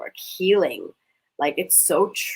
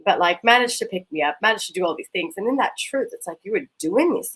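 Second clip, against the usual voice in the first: ordinary delivery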